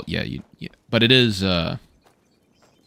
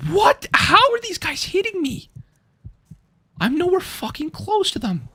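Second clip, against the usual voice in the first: high, outraged squeal; shocked disbelief. Real, not acted. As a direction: yelp in total disbelief. Hit by enemies from a distance.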